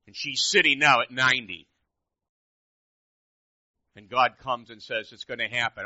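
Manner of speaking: very loud, driving preacher's voice; forceful preaching. Real, not acted. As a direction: hammer each phrase at the congregation.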